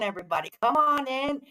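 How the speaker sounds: ordinary delivery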